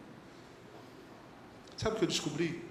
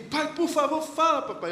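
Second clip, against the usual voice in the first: put-on begging child; 8 semitones higher, 3 dB louder than usual. Child begging father for secret.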